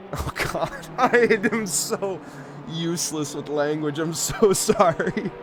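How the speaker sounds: slow, laughing apology; words breaking up in real laughter; helpless laughter. Real, not acted. Commentator apologising for botched German, laughing helplessly. Cracks up at own failed pronunciation.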